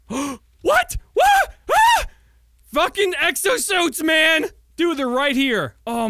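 loud, high shouts of disbelief; gasp, then shriek with astonished delight; overreacting excitement. Real, not acted. Player stumbling on exosuits, wildly overexcited shouting. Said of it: "I probably am overreacting".

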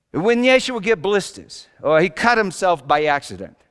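ordinary talk, nothing marked

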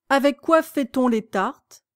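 ordinary delivery, nothing marked

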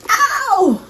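high imitated rock-singer yelp "Ow"; imitated rock scream. Reacting to the song, a high mock rock-star "Ow". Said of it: "It goes like".

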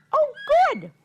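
high delighted exclaim. Real, not acted. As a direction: squeal with glee. Delighted reply to kids.

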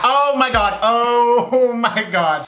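high, laughing panic; panicked laughing oh my God. Real, not acted. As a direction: squeal through a laugh. Beet juice spills on counter.

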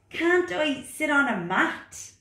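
high, loud, protesting frog voice; reluctant frog. Acted. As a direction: whine back like a stubborn little frog. Frog character.

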